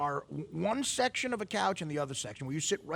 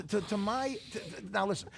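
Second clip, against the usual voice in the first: ordinary talk, nothing marked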